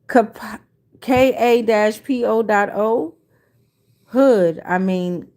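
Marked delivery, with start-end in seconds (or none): none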